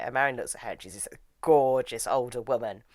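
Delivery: ordinary talk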